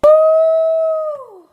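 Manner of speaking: high, loud cheer; hyped woo. Real, not acted. Thrilled over her salsa, whooping loudly. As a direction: let out a big whoop. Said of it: "Sorry, I'm hyped".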